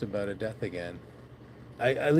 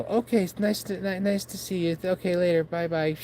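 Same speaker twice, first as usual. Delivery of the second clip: high, hurried polite goodbye; backing away, high and quick; nervous retreat; 7 semitones higher than usual. Retold or imitated.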